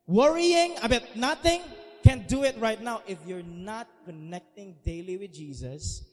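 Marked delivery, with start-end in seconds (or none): 0.1-3.0 loud, high fervent preaching; preaching at full force. Acted. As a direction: belt it out like a revival preacher.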